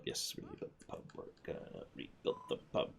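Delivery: breathless excited whisper. Acted. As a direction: hush it like a secret plan. Whispered echo of the plan.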